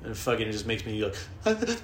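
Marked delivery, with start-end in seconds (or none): 1.4-1.8 much higher, slow nervous stammer; timid reply while shaking; shaky nervous. Retold or imitated. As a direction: stammer it out scared. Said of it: "I start shaking".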